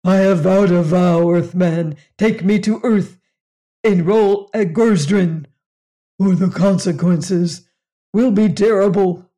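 loud booming alien bellow. Acted. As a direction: roar the vow at the Earthman. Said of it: "He bellowed".

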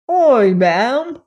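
loud, high Cockney lad; eager Cockney boy calling out; strong Cockney. A narrator's reading. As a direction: pipe up in broad Cockney. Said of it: "he said with a strong Cockney accent".

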